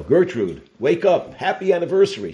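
high, loud, beaming greeting to his wife; cheerful wake-up call. Retold or imitated. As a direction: chirp it out loud and happy. Husband wakes second wife.

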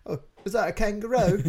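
a light, laughing fake Australian accent. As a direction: put on a hint of Australian accent. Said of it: "was that your Australian accent?".

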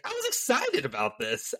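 high, wavering mimicry with "excited" lifted; fluctuating voice. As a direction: swing "excited" high, then let "about this" fall. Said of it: "the fluctuation of his voice".

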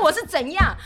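high, loud laughing protest; a baffled laughing what did I do; indignant laugh. Retold or imitated. Mother retelling her reply to the guard, high and laughing. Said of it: "我说".

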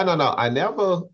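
normal talking voice

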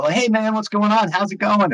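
high, cheery imitation of a friend's hello; happy greeting. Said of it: "he's, like, happy".

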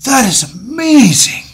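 high, loud wonder; hammed-up amazement; amazed. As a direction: gush over the tape. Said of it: "he goes".

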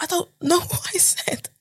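laughing through the words, pitch up high; giggling helplessly while retelling. Real, not acted. Speaker recalling her accidental yes, laughing. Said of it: "I was like".

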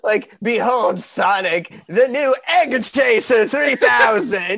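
slow, loud cartoon villain boast; booming echoing villain monologue. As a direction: announce it like a Sonic boss. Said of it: "that sounds like a Sonic villain".

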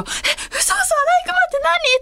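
very high, startled mom; excitable mother. Retold or imitated. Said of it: "いつもの私のお母さんだったら".

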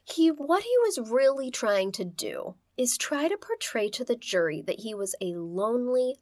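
ordinary talk, nothing marked

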